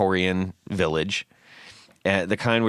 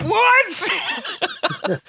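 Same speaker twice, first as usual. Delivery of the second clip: shocked and cracking up, pitch way up; astonished reaction. Retold or imitated. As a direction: retell it with a shrieked, incredulous "what". Retelling astonished reaction to the horse.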